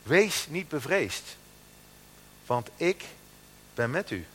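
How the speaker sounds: slow, solemn scripture reading. Acted. Preacher reading God's words to the congregation, slow and solemn. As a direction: read God's promise slowly and gravely.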